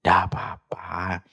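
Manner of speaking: ordinary talk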